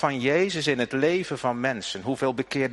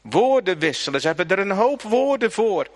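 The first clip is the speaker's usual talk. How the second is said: louder, higher, fervent sermon voice; preaching at full force; 7 semitones higher, 5 dB louder than usual. Acted. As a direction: preken met volle kracht, luider en hoger. (preken met volle kracht)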